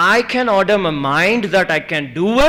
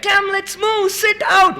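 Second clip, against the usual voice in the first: high, urgent instructor's call; a student retelling his instructor, voice pushed high; instructor's command; 13 semitones higher than usual. Retold or imitated. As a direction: hurry the jumper to the door. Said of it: "And then he said".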